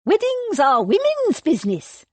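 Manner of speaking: high, loud, scornful dowager; mock his words with contempt; scathing old lady. A narrator's reading. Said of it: "fixed him with a scathing glare".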